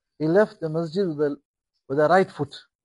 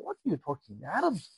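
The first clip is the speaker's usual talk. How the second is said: scoffing; 10 dB quieter than usual. Acted. Arabs scoffing at the Prophet.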